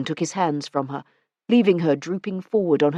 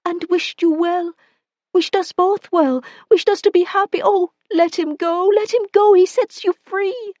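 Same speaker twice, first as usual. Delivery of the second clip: much higher, pleading woman's voice; woman gives way under his grip, pleading; frightened woman; 13 semitones higher, 3 dB louder than usual. Acted. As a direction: plead with him, afraid.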